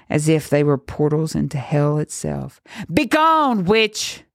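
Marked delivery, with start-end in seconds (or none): 2.9-4.2 high, sharp command to banish her; priest's shout. A narrator's reading. Priest ordering the witch out of the church, high commanding shout. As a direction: cast her out with a raised voice. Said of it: "the priest shouted".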